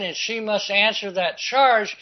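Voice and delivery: normal talking voice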